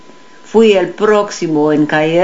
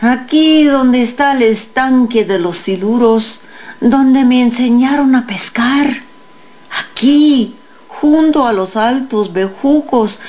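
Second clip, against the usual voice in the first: higher-pitched calling, no real shout; narrated cry; 6 semitones higher than usual. A narrator's reading. Boy calls out his place by the river, understated shout. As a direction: call out where you are, voice lifted. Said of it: "grité".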